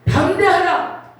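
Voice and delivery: God commanding. Retold or imitated. God telling her to be brave, slow loud command. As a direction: command courage slowly. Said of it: "뭐라 그러시냐면은".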